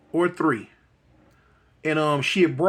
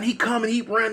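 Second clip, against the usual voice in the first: normal talking voice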